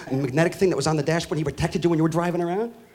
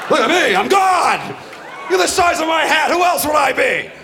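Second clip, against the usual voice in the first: mock God shouting high and slow; hammed-up almighty voice; booming God; 8 semitones higher, 3 dB louder, slower than usual. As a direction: bellow as a vain God. God voiced in stand-up bit.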